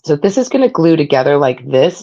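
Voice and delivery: ordinary talk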